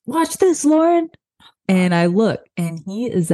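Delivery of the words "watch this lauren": high, cocky call to his sister; showing-off kid. Retold or imitated. Jacob about to ride the escalator, high boastful call. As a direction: brag before the stunt. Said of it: "I hear Jacob go".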